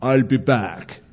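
slow, lightly voiced action-hero line; a hint of a movie tough guy, drawn out; Schwarzenegger impression. Preacher likening the devil to a movie villain, light slow impression. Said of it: "He's like Schwarzenegger".

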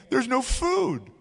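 high-pitched mock complaint, easing down at the end; complaining. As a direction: grumble like a whiny crowd. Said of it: "they started complaining again".

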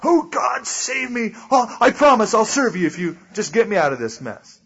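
high-pitched mock panicked plea; desperate calling out. Unbeliever in hot water begging God, hammed-up desperation. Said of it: "they start calling out to God".

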